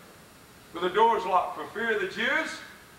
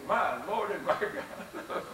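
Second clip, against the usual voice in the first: awed hush; 6 dB quieter, slower than usual. Acted. Thomas awed before risen Jesus.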